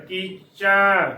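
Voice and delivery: ਕੀਚੈ drawn out as "kee-chai"; correct open "ai". Shown as a demonstration. Teacher corrects congregation's misreading, ਕੀਚੈ with open ending. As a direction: hold the wide "ai" at the end. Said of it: "ਜਦ ਕਿ ਇੱਥੇ ਚੱਚੇ ਨੂੰ ਦਲਾਵਾਂ ਚੈ".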